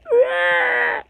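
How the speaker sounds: a tiny high roar, not scary; high squeaky roar. Shown as a demonstration. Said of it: "it wouldn't sound like".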